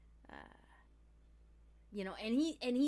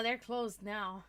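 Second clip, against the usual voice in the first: ordinary talk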